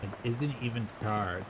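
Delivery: ordinary talk, nothing marked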